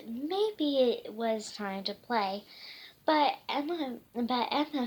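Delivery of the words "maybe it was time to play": ordinary delivery, nothing marked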